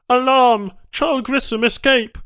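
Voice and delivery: high, loud alien yell; alarm shout. A narrator's reading. Banu raising the alarm over the escape, loud alien yell. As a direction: blare the escape warning.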